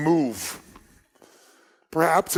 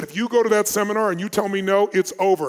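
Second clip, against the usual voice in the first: louder, higher imitation of a threatening boyfriend; retold ultimatum; 5 semitones higher, 6 dB louder than usual. Boyfriend warning her off the seminar, retold as an ultimatum. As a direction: lay down the threat as he did.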